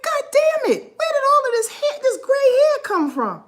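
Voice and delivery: high, loud mock exasperation; high-pitched disbelief over gray hairs; exasperated inner voice. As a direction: squeal it out in disbelief. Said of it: "I was like".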